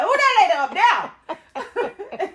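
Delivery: loud, high, laughing who that lady; mock loud question. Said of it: "She didn't say it like that".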